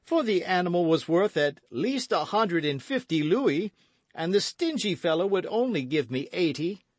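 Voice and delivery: high, hearty, booming host; boisterous Porthos. A narrator's reading. Porthos welcoming friends to dinner, boisterous. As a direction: play an expansive, boastful big musketeer.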